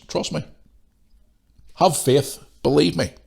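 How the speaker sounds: ordinary delivery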